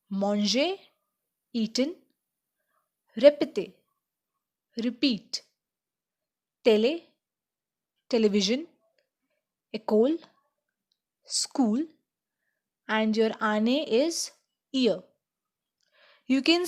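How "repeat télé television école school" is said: ordinary talk, nothing marked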